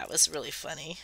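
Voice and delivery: normal talking voice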